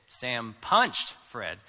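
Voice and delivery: slow line with all the weight on "punched"; stress on punched. Shown as a demonstration. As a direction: punch out the middle word, punched. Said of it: "you know he punched him".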